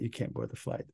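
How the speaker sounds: near-whispered calm refusal; not loud. Retold or imitated. Gate agent quietly refusing the drunk passenger, hushed. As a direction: keep it hushed so others can't hear. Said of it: "he didn't say it loud".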